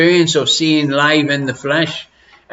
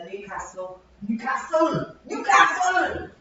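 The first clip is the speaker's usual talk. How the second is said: mock call from afar; 9 semitones higher, 9 dB quieter, slower than usual. Real, not acted.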